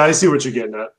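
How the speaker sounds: normal talking voice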